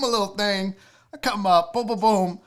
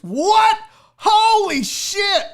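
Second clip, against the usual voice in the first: loud, higher, slow shocked outburst; stunned shout; 9 semitones higher, 9 dB louder, much slower than usual. Real, not acted. Comic sees the old pants photo, shouting in shock. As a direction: gasp it out at the photo. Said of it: "look at that".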